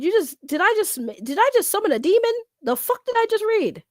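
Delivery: high-pitched, incredulous questioning; rising disbelief, half-joking panic; mock alarm. Real, not acted. Streamer fearing the strange words summoned a demon, mock alarm.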